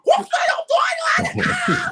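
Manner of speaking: high, loud laughing yelp; laughing shout. Real, not acted. Comic cracks up loudly at his own line. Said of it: "laughing shout".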